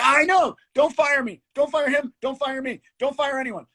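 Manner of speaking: high, loud frantic begging; panicked pleading. Retold or imitated. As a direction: plead in a rising panic not to be fired. Retold panicked pleading to director.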